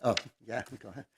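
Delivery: usual speaking voice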